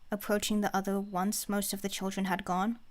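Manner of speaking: ordinary delivery, nothing marked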